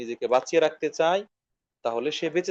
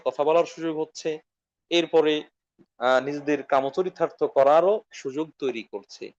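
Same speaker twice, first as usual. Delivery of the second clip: ordinary talk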